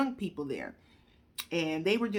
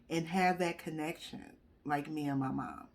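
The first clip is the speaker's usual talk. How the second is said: ordinary talk, nothing marked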